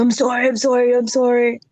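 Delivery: high, lightly mocked pleading; pleading homeless man. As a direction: homeless man apologizing to the son. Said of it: "I go".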